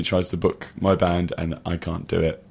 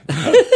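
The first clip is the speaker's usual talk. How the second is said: high squeal of laughter; 7 dB louder than usual. Real, not acted. Laughing at own accent.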